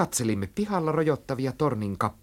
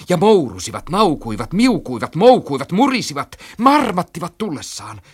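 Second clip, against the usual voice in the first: high, loud, swooping verbs like cat cries; yowling narration; 9 semitones higher, 9 dB louder than usual. A narrator's reading. Narrator lists the oncoming cats' noises, excited yowling. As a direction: swell and swoop on each verb.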